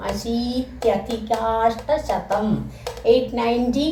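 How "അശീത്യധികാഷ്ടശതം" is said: അശീത്യധികാഷ്ടശതം said slowly as one fused word; slow, syllable-careful compound; teacher modelling the fused number slowly; slow joined form. Shown as a demonstration. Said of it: "അശീതി plus അധിക plus".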